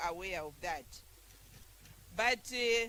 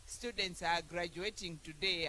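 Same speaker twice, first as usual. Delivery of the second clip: ordinary talk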